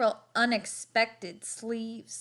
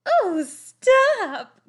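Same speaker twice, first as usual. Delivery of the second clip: high, loud, flattered giggle; giggling; 11 semitones higher, 8 dB louder than usual. A narrator's reading. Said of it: "giggled Cousin Clara".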